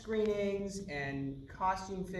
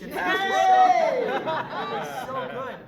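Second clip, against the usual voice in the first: laughing delight; 11 semitones higher, 13 dB louder, slower than usual. Real, not acted. Gushing with laughter over impression.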